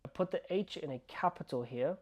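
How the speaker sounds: ordinary delivery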